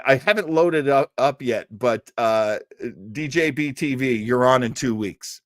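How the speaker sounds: ordinary talk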